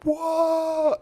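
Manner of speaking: a huge, high, loud cheer of wonder; crowd going whoa. Retold or imitated. Crowd cheering at the exploding balloon, loud amazed whoa. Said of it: "they're all like".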